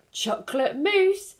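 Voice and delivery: a lightly voiced goose longing for mousse; greedy goose. A narrator's reading. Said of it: "says greedy goose".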